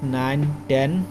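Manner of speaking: ordinary talk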